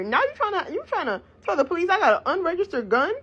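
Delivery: high-pitched, incredulous outrage; indignant disbelief. Real, not acted. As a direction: pitch climbs as you call out the lie. Indignant at his lie to police.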